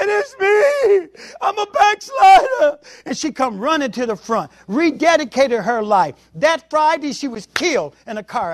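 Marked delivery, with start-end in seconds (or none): 0.0-2.8 much higher, crying shout; sobbing woman shouting her confession. Retold or imitated. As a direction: cry it out like a broken woman.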